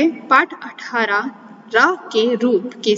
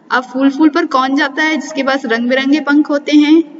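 normal talking voice

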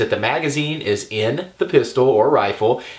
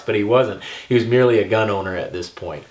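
ordinary talk, nothing marked

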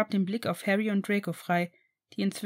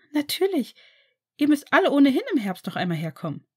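higher, fast and eager; bright Sarah; 6 semitones higher, 5 dB louder, faster than usual. A narrator's reading. Sarah inviting everyone back, higher and fast. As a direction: answer quickly with eagerness.

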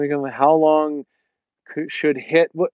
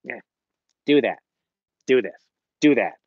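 imitating bossy orders. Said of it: "that's how she talks to him".

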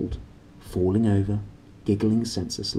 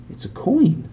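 put-on surprised boy; 14 semitones higher than usual. A narrator's reading. Roger surprised.

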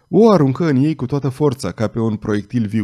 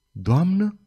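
„Doamnă?" lifted higher into a surprised question; startled echo; 6 semitones higher than usual. A narrator's reading. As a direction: echo „Doamnă?" as if caught off guard, rising at the end. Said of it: "repetă Saizuma tresărind".

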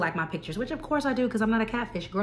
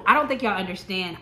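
ordinary talk

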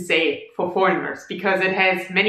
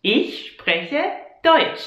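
slow, careful Ich spreche Deutsch; slow repeat; 4 semitones higher, slower than usual. Shown as a demonstration. Teacher slowly repeating the example for learners. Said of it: "So for example, you can say".

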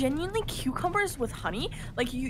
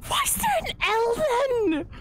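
very high, exasperated outcry; indignant protest; 13 semitones higher, 4 dB louder than usual. Real, not acted. Arguing with friends about the silent L, high exasperated protest. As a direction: demand an answer, pitch leaping up.

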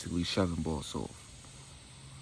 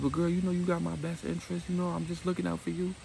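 put-on much higher, pleading girl's voice; high girlish voice; 11 semitones higher than usual. Destiny to Victoria, high pleading girl's voice.

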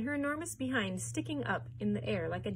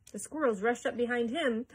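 ordinary delivery

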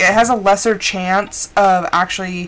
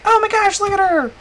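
high-pitched imitation of scandalized onlookers; mock onlookers; 10 semitones higher than usual. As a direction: put on a high voice, mocking the critics. Imagined listeners catching an accent slip.

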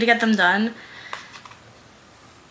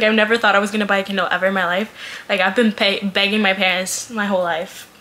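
normal talking voice

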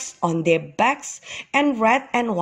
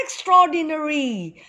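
excited; 8 semitones higher than usual. A narrator's reading. Young ox sees the cave's rocks, excited. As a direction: marvel in a high, excited storybook voice. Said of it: "said Ex-Eddie Ox excitedly".

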